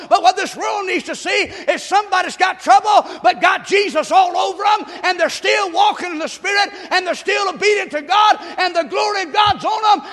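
loud, high-pitched revival preacher; full-force preaching. Real, not acted. Pastor urging the church toward God's blessings, full-force preaching. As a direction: shout it out to the congregation, building to a long cry.

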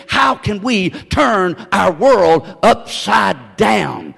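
drawn-out key question; weighty, deliberate sermon question; slow emphatic preaching. Real, not acted. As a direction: pose it slowly, stretching each word.

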